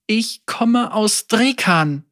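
put-on high, slow, timid girl; young girl voice. A narrator's reading. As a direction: voice a scared little girl. Frightened girl begins her story.

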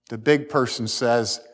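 ordinary talk, nothing marked

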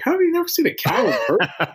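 high-pitched, laughing disbelief; incredulous. Retold or imitated. Small-town guy to his city teammate, incredulous. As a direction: retell it in mock astonishment, voice jumping up.

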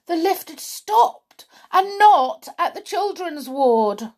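ordinary delivery, nothing marked

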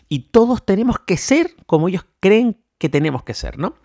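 fired-up mini rant against closed minds; getting carried away. Real, not acted. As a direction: let yourself get worked up about it. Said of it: "me he venido arriba con el tema".

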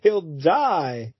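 high, pleading mock damsel; hammed-up high desperate pleas; frantic heroine. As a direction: beg to be let go, hammed up. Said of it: "Debbie's like".

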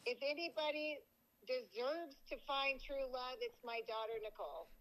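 ordinary talk

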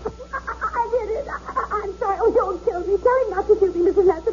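high, trembling, near tears; terrified pleading. Acted. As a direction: beg for your life in terror. Hostage begs robber for life.

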